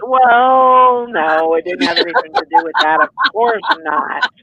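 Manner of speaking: stretched-out mock-innocent denial. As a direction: deny it with playful over-emphasis. Teasing mock denial about staying up.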